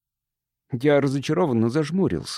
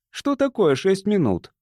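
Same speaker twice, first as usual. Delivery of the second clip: higher, slower, mildly contemptuous question; scornful dismissal; 8 semitones higher than usual. A narrator's reading. Jimmy dismissing six extra minutes to the captain, scornfully. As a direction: belittle the objection with scorn. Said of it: "презрительно отмахнулся он".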